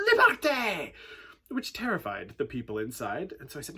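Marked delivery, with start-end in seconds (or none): 0.1-0.9 loud, high rallying shout; group yell. Retold or imitated. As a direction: yell it like a crowd of freed passengers. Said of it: "six French people all yelled".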